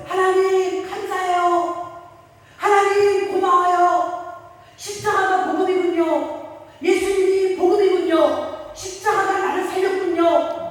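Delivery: 높고 느리게 벅찬 감사; 하나님께 벅차게 감사하듯 높고 천천히; 감격한 감사 기도. Retold or imitated. 꿈속 권사님의 감사 기도. (높고 느린 감격의 감사 기도)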